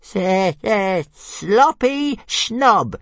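high, very slow stuttering jeer; sing-song the insult to provoke her; jeering little dragon. A narrator's reading. Tiny dragon jeering at Fireworm, high and slow. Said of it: "jeered Toothless".

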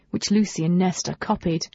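ordinary delivery, nothing marked

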